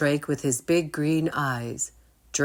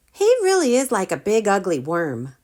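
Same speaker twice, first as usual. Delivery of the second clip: loud, higher sneer; mocking; 5 semitones higher, 6 dB louder, faster than usual. Rory mocks Drake's dragon, loud and sneering. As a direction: sneer at the worm. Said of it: "Rory said".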